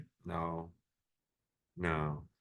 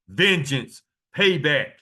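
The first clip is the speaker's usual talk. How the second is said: louder and higher, each word punched; emphatic preaching; 4 semitones higher, 6 dB louder, slower than usual. Real, not acted. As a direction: punch both words. Preacher drives home key word.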